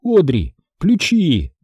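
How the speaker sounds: high urgent call for the keys; Jenny shouting to Audrey in panic. Acted. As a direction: yell it at her friend. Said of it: "крикнула она".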